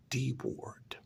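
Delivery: ordinary delivery, nothing marked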